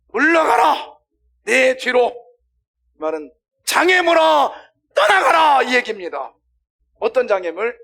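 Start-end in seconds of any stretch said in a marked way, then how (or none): 0.1-2.1 very loud, high shouted command; angry rebuke. Acted. Preacher acts out Jesus banishing Satan, angry rebuke. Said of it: "화를 내고 있는 거예요". 3.6-5.6 very loud, high shouted command; angry rebuke. Acted. Preacher acts out Jesus banishing Satan, angry rebuke. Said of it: "화를 내고 있는 거예요".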